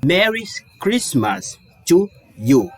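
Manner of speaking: slow, careful English read; slow model sentence. Shown as a demonstration. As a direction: read it out slowly for learners. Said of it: "vou dizer assim".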